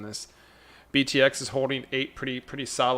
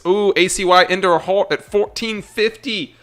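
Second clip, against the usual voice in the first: excited; 7 semitones higher, 11 dB louder than usual. Real, not acted. Trader excited at a halt.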